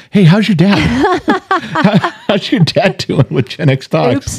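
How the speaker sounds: high, laughing fan voices asking; gushing followers. Retold or imitated. Livestream followers asking the daughter about her dad, high and laughing. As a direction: mimic eager stream followers, half laughing. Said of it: "She goes".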